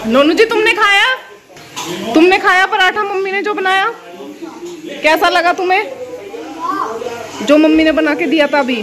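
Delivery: high, loud cooing at the pet. Real, not acted. Mother coos at her pet Nonu, high and sing-song.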